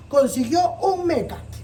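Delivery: usual speaking voice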